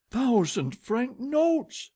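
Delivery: high, slow cry of disbelief; cried out. A narrator's reading. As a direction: cry it out, stretched and shrill with shock. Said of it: "she cried".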